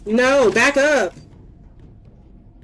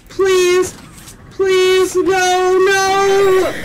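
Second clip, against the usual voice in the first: high loud terrified begging; terrified pleading; 6 semitones higher, 4 dB louder, much slower than usual. Real, not acted. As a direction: beg for your life. Begging as monster attacks.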